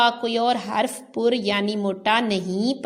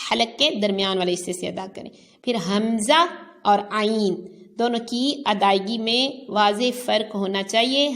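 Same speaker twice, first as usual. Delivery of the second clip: ordinary delivery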